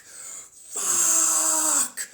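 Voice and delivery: long, high, full-throated scream; drawn-out scream. Acted. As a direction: let the curse rip in a long wail. Screamed after the whispered one.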